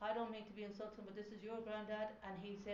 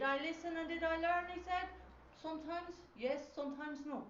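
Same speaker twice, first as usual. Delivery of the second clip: lightly voiced grandfather, higher and louder on the question; granddad's voice raised; 9 semitones higher, 6 dB louder than usual. Medium relays the granddad's question to the client in a raised voice. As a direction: lift it up as if the old man is asking himself.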